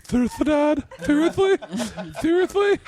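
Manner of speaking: high, laughing disbelief at his dad; hammed-up squeaky outrage, half laughing; whiny kid protest. Eleven-year-old begging dad not to play, high whine. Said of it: "was like".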